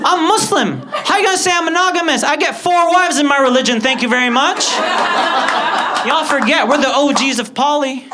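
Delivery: high, loud indignant rant; hammed-up righteous indignation; mock outrage. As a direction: go full offended diva. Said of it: "I was offended this time".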